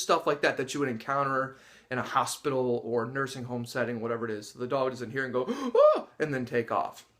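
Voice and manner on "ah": mock startled gasp. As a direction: imitate a spooked dog's gasp. Imitates dog startled by noise.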